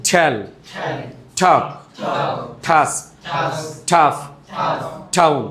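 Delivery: single words ঠেল, ঠপ, ঠাস, ঠাফ, ঠাউন, each starting with a puff; aspirated ঠ opening ঠাস and ঠাফ; aspirated T drill. Shown as a demonstration. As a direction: burst air on the T of each word, ঠেল to ঠাউন. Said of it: "টি এর উচ্চারণ ঠ".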